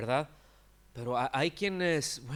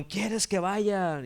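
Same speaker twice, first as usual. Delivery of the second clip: imitated zeal, pitched up and drawn out; zealous servants; 7 semitones higher, 6 dB louder, much slower than usual. Zealous servants offer to pull up the weeds, imitated eagerness. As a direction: play servants keen to jump in.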